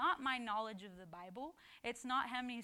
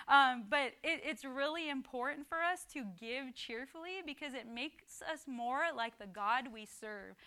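ordinary delivery

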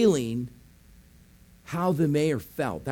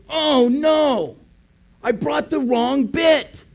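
dismayed missionary; 9 semitones higher, 5 dB louder, slower than usual. Retold or imitated. Missionary realizes his mistake.

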